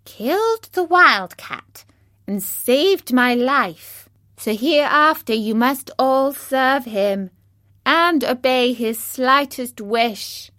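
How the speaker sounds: high, loud, slow royal squeak; commanding little mouse queen. A narrator's reading. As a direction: give a slow, high-voiced command to your subjects. Narrator voices the mouse queen.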